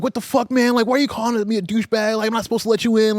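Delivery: high, pushed-up angry rant; mock angry guy. The pizza guy yelling at the friends, mock angry. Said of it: "getting real mad at us".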